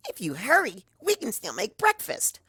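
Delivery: very high, loud, bouncy pony voice; bubbly Pinkie. Acted. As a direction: burst with glee at a friend waking. Pinkie urges Twilight to breakfast.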